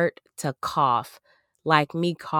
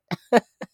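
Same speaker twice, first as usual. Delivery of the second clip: quick amused laugh; a brief chuckle; short laugh; 6 semitones higher, 6 dB louder than usual. Real, not acted. Laughs at own remark.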